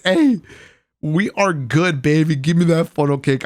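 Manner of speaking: loud high Hey, then greedy; excited. Retold or imitated. Vlogger claiming his funnel cake from friends, excited.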